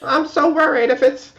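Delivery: teasing high-pitched worrier; mocking voice. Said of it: "You even gave me a mocking voice".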